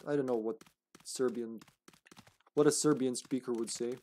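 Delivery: ordinary talk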